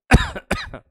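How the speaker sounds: loud, unvoiced coughing fit; real cough. Real, not acted. Teacher with a cough interrupting his own lecture, loud coughing. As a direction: cough hard, breaking off the lesson. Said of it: "ताकि खांसी ना आए".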